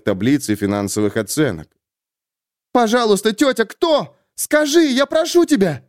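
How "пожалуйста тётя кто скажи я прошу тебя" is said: high, desperate begging; pleading young woman. A narrator's reading. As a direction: beg her, voice climbing. Sulin begs aunt.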